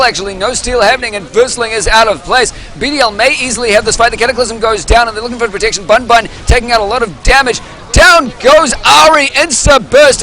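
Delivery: high, fast hyped fight call; live play-by-play. Acted. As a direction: ride the fight like a caster.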